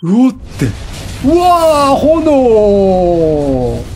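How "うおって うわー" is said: normal talking voice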